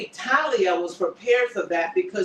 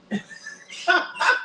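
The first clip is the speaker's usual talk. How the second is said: breathy high laugh; a real laugh at the hypocrisy; 10 semitones higher than usual. Real, not acted. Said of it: "I'm like, so funny".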